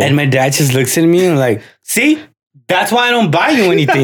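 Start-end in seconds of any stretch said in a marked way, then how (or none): 1.8-4.0 dad's put-down, raised high; a smug, cutting father's jab; father scolds his son over the broken toy, smug and raised; mock smug dad.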